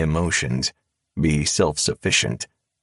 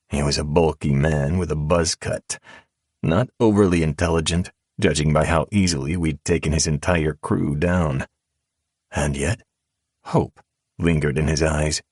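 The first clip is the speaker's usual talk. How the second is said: ordinary delivery, nothing marked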